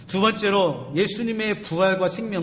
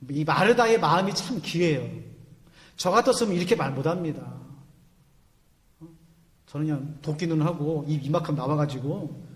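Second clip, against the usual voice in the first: ordinary delivery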